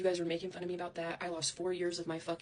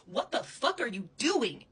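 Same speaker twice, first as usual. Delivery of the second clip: angry demand; 4 semitones higher, 6 dB louder, slower than usual. Real, not acted. Angry repeated question.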